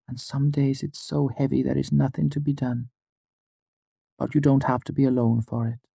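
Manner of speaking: a gentle waif soothing a grieving friend; small fey girl, tender. A narrator's reading. Auri comforts him at length.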